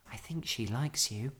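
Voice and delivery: soft, hushed confiding; whispered. A narrator's reading. Girl reassuring bear about housekeeper, whispered. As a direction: confide it softly. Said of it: "whispered Judy".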